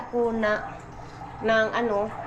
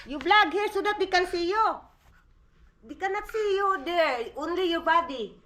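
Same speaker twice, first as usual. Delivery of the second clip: playful coaxing; 9 semitones higher, 4 dB louder than usual. Real, not acted. Mother coaxes her son to vlog on camera, high and laughing. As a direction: cheer the little one on toward the camera.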